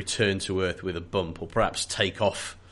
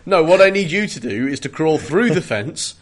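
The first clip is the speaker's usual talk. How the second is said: louder, higher mock briefing; insistent foreman; 5 semitones higher, 6 dB louder than usual. Foreman sending volunteer through the fence, loud and insistent. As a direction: spell out the absurd order firmly. Acts foreman giving absurd order.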